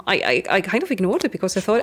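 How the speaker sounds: normal talking voice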